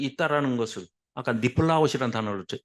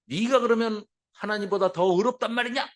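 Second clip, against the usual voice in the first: acted scornful challenge, higher pitched; mocking rebuke; 6 semitones higher than usual. Preacher voices Job's friend berating him, mocking rebuke. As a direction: throw it out as a jeering, scolding question. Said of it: "조롱하고 질타하고".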